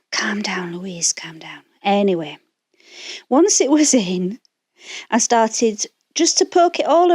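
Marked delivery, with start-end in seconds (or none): 0.1-1.6 whispered self-talk to calm down; breathy hush, telling herself to calm down; whispering. Real, not acted. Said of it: "when I'm getting flustered".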